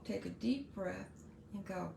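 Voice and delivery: ordinary talk, nothing marked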